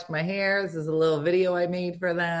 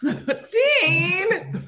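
name said through a laugh; 12 semitones higher than usual. Real, not acted. Laughing at her son.

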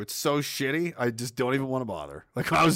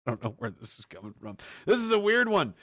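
ordinary delivery

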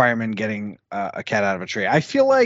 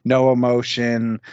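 stoic monotone; 6 semitones lower than usual. Retold or imitated. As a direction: imitate a stoic with no inflection. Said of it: "trying to hit that stoic monotone".

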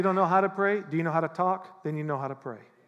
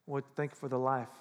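softer and lower, just talking to God; simple sincere prayer; 4 semitones lower, 8 dB quieter than usual. Shown as a demonstration. Preacher showing how to simply talk to God, soft and sincere. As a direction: pray it quietly like a friend. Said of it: "just talk to him".

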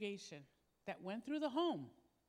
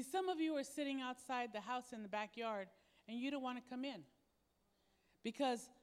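ordinary talk, nothing marked

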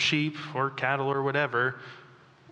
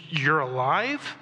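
slow question with a steep rise at the end; stunned disbelief; slower than usual. Acted. Mary turning to find her teacher alive, stunned disbelief. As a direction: draw it out as an incredulous question.

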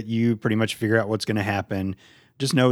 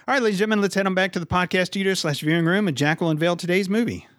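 raised, showy host announcement; announcer voice; 8 semitones higher than usual. Acted. Hosts' announcer segue.

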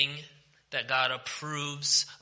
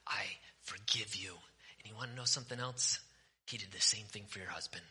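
near whisper, much softer; hushed, intimate; 3 semitones lower, 10 dB quieter than usual. Real, not acted. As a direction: lean in and speak it softly like a secret. Softly pleading with the listener.

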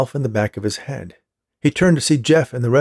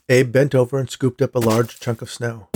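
usual speaking voice

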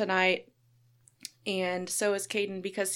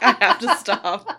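loud, helpless laughter through the words; laughing quote; 12 dB louder than usual. Retold or imitated. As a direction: retell it while cracking up. Laughing as she retells her accent slip.

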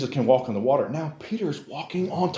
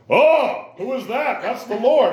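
lightly played calm manly voice, higher; a hint of a brave disciple pretending to be cool; mock manly; 10 semitones higher, 5 dB louder, slower than usual. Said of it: "I don't think this was a manly".

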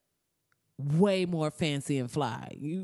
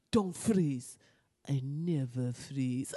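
imitating Russian accent; 5 semitones lower, 4 dB quieter, much slower than usual. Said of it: "a Russian accent".

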